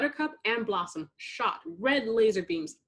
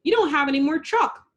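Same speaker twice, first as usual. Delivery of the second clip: a sharp, louder snap, higher; snapping; 7 semitones higher, 5 dB louder, faster than usual. A narrator's reading. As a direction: snap it back at her sister. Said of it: "Buttercup snapped".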